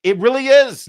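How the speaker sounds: high, loud, amused yes-indeed; answering the producer's quip, high and loud; emphatic agreement. Real, not acted. Host agrees with producer's joke.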